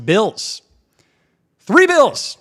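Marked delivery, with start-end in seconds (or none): 1.6-2.4 loud, high exclamation of disbelief; incredulous shout. Real, not acted. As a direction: shout it out, aghast at the number. Outraged at tiny record.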